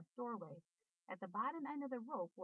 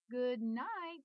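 loud, higher disgusted scream; 4 semitones higher, 5 dB louder than usual. A narrator's reading. Boy screams at the rotten egg smell, loud. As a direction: yell it at the stink. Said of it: "screamed Avery".

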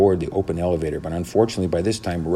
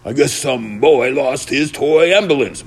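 loud, slow, higher musing voice; dog owner's drawn-out remark; muttering man; 7 semitones higher, 6 dB louder, slower than usual. A narrator's reading. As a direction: puzzle it out slowly to yourself. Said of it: "muttered the man, more awake now".

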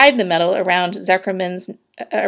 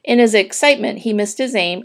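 ordinary delivery, nothing marked

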